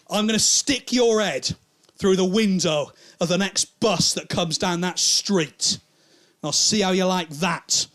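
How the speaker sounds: slow, emphatic acted threat; menacing threat. Drunk man pinning comic to wall, menacing threat. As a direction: spell the threat out word by word.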